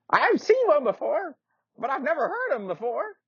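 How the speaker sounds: put-on very high, creaky old-man squeak; a doddery old knight, indignant; old knight insists he is still strong.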